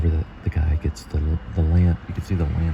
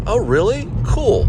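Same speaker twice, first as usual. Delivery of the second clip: excited friend; 4 dB louder, slower than usual. Retold or imitated. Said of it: "Nancy's like".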